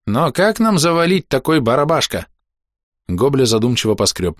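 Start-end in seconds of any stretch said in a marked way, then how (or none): 0.1-2.3 high puzzled goblin. Acted. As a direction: scratch your head, baffled creature. Goblin character's odd grammar.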